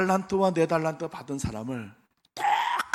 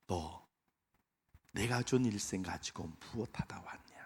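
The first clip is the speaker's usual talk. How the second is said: God's question, whispered; 5 semitones lower, 10 dB quieter than usual. Pretend. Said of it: "하나님이 이렇게 물어보실 것 같아요".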